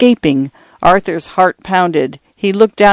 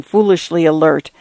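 ordinary delivery

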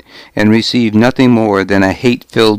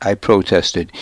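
ordinary delivery, nothing marked

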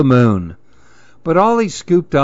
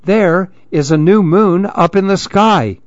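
high, urgent look look look; the oldest monkey pointing at the sky in amazement; excited call; 6 semitones higher than usual. Acted. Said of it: "called to the others".

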